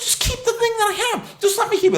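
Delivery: high-pitched indignant pleading; mock outrage, voice pushed way up; exasperated retold demand. Customer insisting on keeping his old plan, high exasperated demand. Retold argument with cable company.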